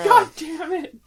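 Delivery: high, loud playful outrage; exasperated cry. Real, not acted. Co-host being teased by a mock trailer, exasperated cry.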